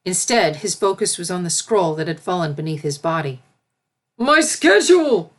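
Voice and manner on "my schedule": high, loud, slow cry of dismay. A narrator's reading. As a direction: cry out over the ruined schedule. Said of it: "he cried".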